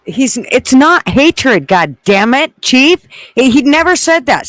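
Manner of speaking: loud, worked-up protest; heated protest. Real, not acted. Heated exchange with chat.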